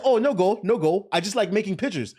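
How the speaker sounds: breezy, high-pitched Uber driver in a mock accent; random foreign accent. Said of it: "just doing a random accent".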